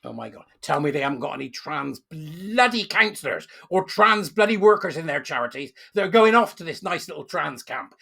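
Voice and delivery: ordinary delivery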